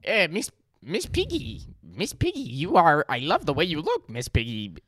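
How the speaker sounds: Kermit impression. Charades player wooing Miss Piggy as Kermit, badly faked frog voice. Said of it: "how do I do this accent".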